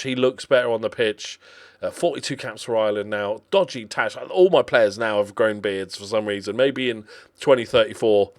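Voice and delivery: usual speaking voice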